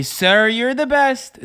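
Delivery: high, slow, put-on fan voice; mock fan. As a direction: gush it out in a silly fan voice. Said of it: "walk up to me and say".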